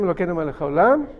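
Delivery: usual speaking voice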